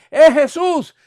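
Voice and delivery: calling out the butcher's name, loud and high. Retold or imitated. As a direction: hail the butcher across the shop. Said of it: "cuando llegas allí".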